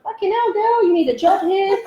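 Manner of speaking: high-pitched, laughing mock amazement; amazed exclamation. Helper telling energy-seer she needs a job, laughing amazement. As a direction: pitch it way up, tickled and incredulous.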